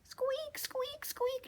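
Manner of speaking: very high whispered squeaks; mouse squeak demo. Shown as a demonstration. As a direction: squeak it high and breathy for them to copy. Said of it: "Let me hear you".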